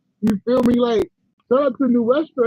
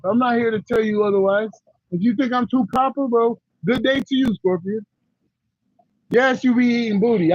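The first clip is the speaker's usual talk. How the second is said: ordinary delivery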